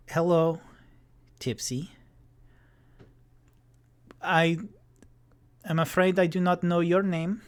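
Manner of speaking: put-on polite but guarded young were-rat; formal were-rat girl. GM voices Cleo, wary were-rat.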